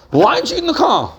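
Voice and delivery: high-pitched mother's scolding question; accusing. Acted. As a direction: demand it sharply like a cross parent. Mother confronts child in acted example.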